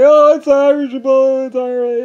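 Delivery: high, loud put-on voice, slow on it's all right; mock reassuring voice. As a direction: fake a breezy all-is-well. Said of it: "they would say".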